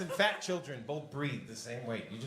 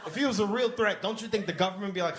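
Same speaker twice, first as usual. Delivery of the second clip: ordinary talk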